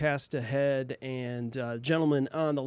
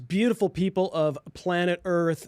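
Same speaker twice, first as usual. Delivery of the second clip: grand entrance announcement; 2 semitones higher, 3 dB louder, slower than usual. Acted. Show opening announcement.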